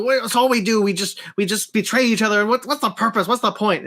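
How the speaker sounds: imitating a world-weary trickster's complaint; fed-up old Loki. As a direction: act out being utterly sick of it all. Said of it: "he's just so sick of Loki".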